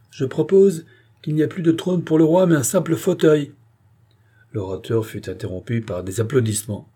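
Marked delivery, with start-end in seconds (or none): 0.1-3.5 louder, higher proposal; terrible ringing voice. A narrator's reading. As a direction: ring it out to the assembly. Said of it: "de cette voix suave, qui ... savait vibrer d'une façon si terrible".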